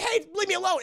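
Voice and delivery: high whiny mock protest; bickering raptor. Second raptor answering the first, high whiny protest. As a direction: whine it like a squabbling dinosaur. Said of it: "He's like".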